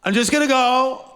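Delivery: high, loud put-on declaration; hammed-up self-important announcement; mock seeker. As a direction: imitate someone grandly announcing an escape. Imitates people seeking a revelation.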